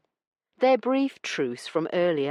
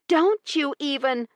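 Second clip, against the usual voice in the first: indignant interruption; 11 semitones higher, 4 dB louder than usual. A narrator's reading. Heroine cuts him off.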